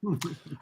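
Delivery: short high burst of laughter; a quick amused laugh before answering; real laugh. Real, not acted. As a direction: laugh at the irony, caught off guard. Laughs at ironic Reagor news.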